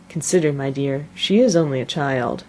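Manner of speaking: usual speaking voice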